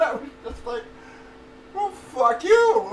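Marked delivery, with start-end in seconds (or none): 1.7-2.9 loud, high, slow drawn-out comeback; mock-furious retort. Patient retelling his answer to the radiation guy, loudly indignant. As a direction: stretch the curse out, voice raised.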